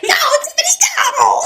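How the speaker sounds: very high mock shout; laughing, shrieked-up imitation of yelling; retold yell. Retelling a yell at the mother mid-delivery, mock shout.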